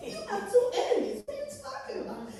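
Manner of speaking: high, laughing "two enemies?"; amused disbelief. Retold or imitated. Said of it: "I said".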